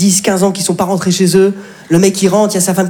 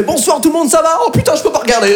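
soldier crying at comedian; 9 semitones higher than usual. Retold or imitated. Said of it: "la vidéo, elle fait".

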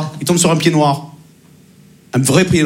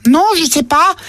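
put-on higher little boy voice; voix d'enfant; 9 semitones higher, slower than usual. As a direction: innocent child shrugging. Enfant répond. (voix d'enfant aiguë)

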